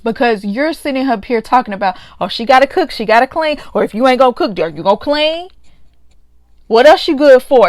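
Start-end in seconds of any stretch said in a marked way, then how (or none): 2.2-7.7 imitating a demanding husband, rising to louder; mock bossy husband ending in a loud jab; mocking chauvinist attitude. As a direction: sneer it out like he's owed a housewife. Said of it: "Type of attitude".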